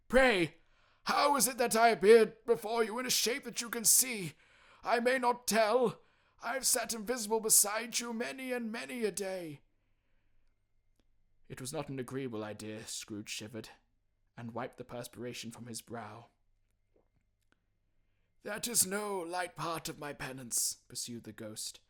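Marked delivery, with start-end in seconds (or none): 0.1-9.6 high, loud mournful spectre; anguished ghost lament, slowing at the end. Acted. Ghost voice in story. 18.4-20.8 high, loud mournful spectre; anguished ghost lament, slowing at the end. Acted. Ghost voice in story.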